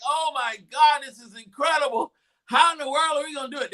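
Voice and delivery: high-pitched acted disbelief; incredulous fan. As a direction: wail in amazement like a stunned fan. Acting out fans' disbelief.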